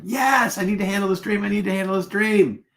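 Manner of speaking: high, pleading barista voice, mock-eager. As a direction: beg to hear the dream in a hammed-up high voice. Barista begging to hear dream.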